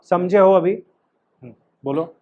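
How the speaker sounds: ordinary talk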